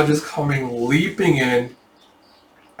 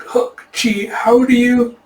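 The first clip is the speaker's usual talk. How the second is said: high, stunned mock protest; flustered shock; 10 semitones higher, 3 dB louder, slower than usual. Taejun asking Hook Chi how he knew, flustered. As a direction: sputter it, pitch raised.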